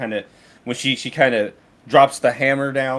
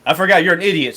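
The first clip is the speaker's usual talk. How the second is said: normal talking voice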